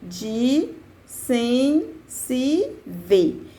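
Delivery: "de sem se ver" in separate syllables, a pause after each; "de... sem... se... ver", spaced out for a class; syllable-by-syllable scansion. Shown as a demonstration. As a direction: count out "de sem se ver" beat by beat, each rising. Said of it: "eu passo a contar".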